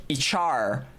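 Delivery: "Ichar" run together as one word, ih-CHAR; "Ichar" with the letters blurred into ih-char; misheard pronunciation. Shown as a demonstration. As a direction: say H R as "Ichar", starting on short i. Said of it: "the name sounds to me like".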